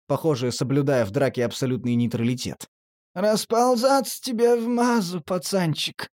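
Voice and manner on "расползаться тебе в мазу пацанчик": high, nasal junkie drawl. Acted. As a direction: say it through the nose. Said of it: "гнусаво заметил Торчок".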